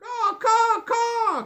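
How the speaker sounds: high cawing crow call. A narrator's reading. Crows spotting the food, cawing high. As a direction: caw like a crow. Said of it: "croaked the crows".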